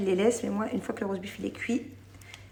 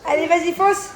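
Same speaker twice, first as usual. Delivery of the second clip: high sweet mom voice; 7 semitones higher, 8 dB louder than usual. Real, not acted. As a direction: coo over the gift like a proud mom. Mother encouraging child.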